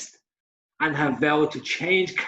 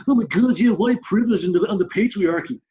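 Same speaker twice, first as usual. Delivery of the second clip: mock woke friend; 4 semitones higher than usual. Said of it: "tell each other and go".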